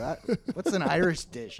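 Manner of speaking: ordinary talk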